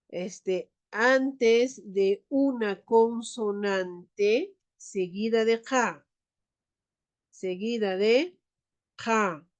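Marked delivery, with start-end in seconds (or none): none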